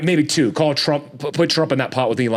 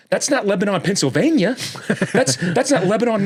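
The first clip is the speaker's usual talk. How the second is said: high-pitched, disbelieving rebuke; incredulous outrage; 7 semitones higher than usual. Acted. Podcaster answering Biden's plea for money, incredulous outrage. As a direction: fire back in exasperated disbelief, pitch leaping up.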